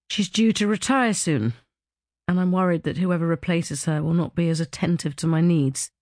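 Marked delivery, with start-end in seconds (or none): none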